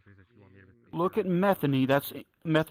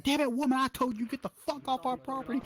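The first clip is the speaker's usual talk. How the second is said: furious yell; 10 semitones higher than usual. Retold or imitated. Bar owner chasing with bat.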